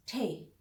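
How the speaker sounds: ठे aspirated; German T. Shown as a demonstration. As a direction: breathe out on ठे. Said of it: "इसके भी एंड में ह की आवाज आनी चाहिए". (ठे, साँस के साथ)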